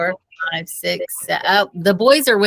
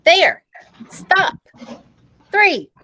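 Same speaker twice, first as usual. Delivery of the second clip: much higher, louder clipped counting; traffic officer commands; 10 semitones higher, 4 dB louder, much slower than usual. Acted. Dave stopping the principal after three words, loud and commanding. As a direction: halt her like you're directing traffic.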